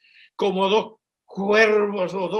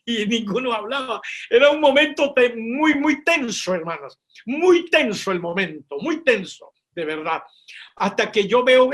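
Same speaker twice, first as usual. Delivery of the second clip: louder, driving preacher's telling; building sermon tension; 3 semitones higher, 5 dB louder than usual. Acted. Preaching the tense standoff. (predicación intensa y más fuerte)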